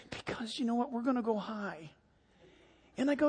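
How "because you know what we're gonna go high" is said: put-on very soft, confiding kid voice; scared little boy. Boy explaining his fear to seatmate, hushed worry. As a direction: imitate a nervous child, hushed. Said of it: "I'm scared".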